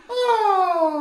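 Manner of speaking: high, airy sighed oh. Shown as a demonstration. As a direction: let it out like a musical yawn. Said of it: "start with our sigh again".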